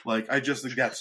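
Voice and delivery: ordinary talk, nothing marked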